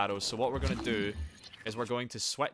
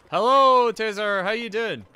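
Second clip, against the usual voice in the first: loud, high hello; exuberant greeting; 10 semitones higher, 12 dB louder than usual. Real, not acted. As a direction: call out a big welcome to a viewer. Greeting a viewer loudly.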